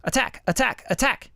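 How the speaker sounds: high attack words; attack demo. Shown as a demonstration. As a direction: hit each attack high. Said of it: "I'm attacking slower".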